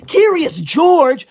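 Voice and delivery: Curious George stretched out in outrage; offended disbelief. Acted. Offended by a nickname.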